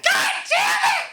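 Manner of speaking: shrill, very high loud curse. Retold or imitated. Old man fed up beside his car, high-voiced shriek. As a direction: mimic an old man's screeching high voice. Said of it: "Just high voice like that".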